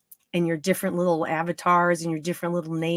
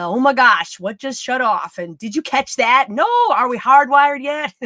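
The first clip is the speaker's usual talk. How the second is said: mock panic, building to a higher, loud question; frantic crew chatter; 4 semitones higher, 8 dB louder than usual. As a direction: imitate a flustered crew, getting louder. Imitates frantic behind-the-scenes crew chatter.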